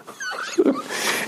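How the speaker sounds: breathy high-pitched laugh; laughing at the absurd scene. Real, not acted. Laughing at his own story.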